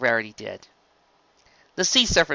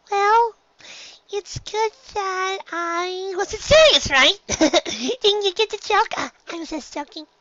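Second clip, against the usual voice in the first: much higher, slow little voice with an awkward laugh; little filly covering up, fading to a murmur; nervous filly; slower than usual. Acted. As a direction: backpedal with a nervous giggle.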